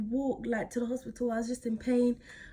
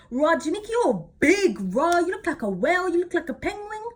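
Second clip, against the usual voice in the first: loud, high teasing dad voice; loud, higher mick-taking impression; mocking dad; 8 semitones higher, 7 dB louder than usual. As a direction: jeer at her like a cheeky dad. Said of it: "took the mick out of me".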